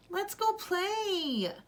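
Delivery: put-on high, slow, eager boy; Zaki's child voice. Boy pulls his sister outside, high child voice. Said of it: "he said".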